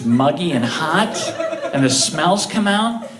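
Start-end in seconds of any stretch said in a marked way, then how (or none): none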